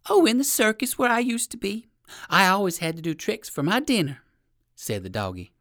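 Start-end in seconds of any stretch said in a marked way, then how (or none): 0.1-4.2 high-pitched performing dog voice; eager little poodle. Acted. Poodle telling the rabbit about the circus, chirpy.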